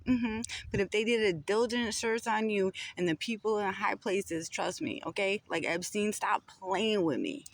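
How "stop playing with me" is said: ordinary talk, nothing marked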